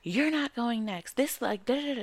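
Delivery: imitated yelling. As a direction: mimic a stranger yelling in a line dispute. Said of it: "starts yelling at me".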